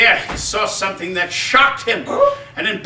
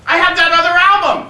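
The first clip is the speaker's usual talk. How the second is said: eager idea; 7 semitones higher, 4 dB louder than usual. Acted. Boy excited by an idea.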